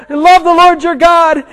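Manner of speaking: high, urgent mock shout; acted shouting. Rider trying verses on runaway dogs, high acted shout. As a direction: call out a verse as a desperate command.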